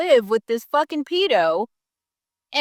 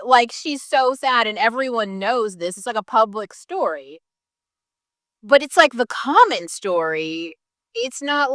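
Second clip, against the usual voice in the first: normal talking voice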